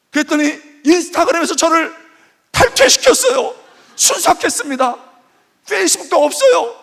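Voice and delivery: mock sobbing complaint; imitating upset young woman. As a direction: keep the jilted girlfriend's wail going. Continues girl's tearful complaint.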